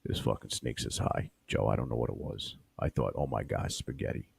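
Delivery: low, hushed storyteller rasp; Joey Diaz. Pretend. [name] as Joey Diaz recounting eating the snake, low and hushed. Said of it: "That's not a bad D S".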